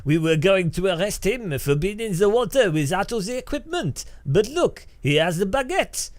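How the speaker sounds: high, loud mock French cop voice; mock French policeman catching a kayaker. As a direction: ham up a pompous French gendarme. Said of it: "they're like".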